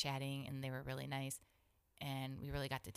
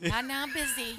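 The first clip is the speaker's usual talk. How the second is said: high, loud mock dismissal; comic "leave me alone"; brushing someone off; 10 semitones higher, 10 dB louder than usual. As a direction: wave off a customer, pitched up and loud.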